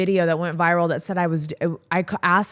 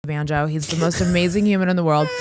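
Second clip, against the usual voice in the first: ordinary talk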